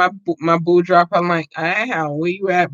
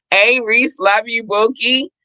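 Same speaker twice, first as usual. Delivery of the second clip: calling out; 8 semitones higher than usual. Real, not acted. Calling out to absent producer.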